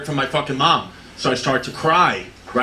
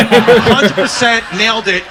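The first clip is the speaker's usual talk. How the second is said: laughing; 6 semitones higher, 8 dB louder, slower than usual. Real, not acted. Loud laughing outburst.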